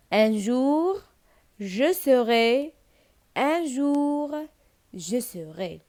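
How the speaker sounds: very slow, measured teacher's recitation; poem recitation. Shown as a demonstration. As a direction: lift each phrase, then let it settle. (إلقاء قصيدة بشوية، جملة بجملة)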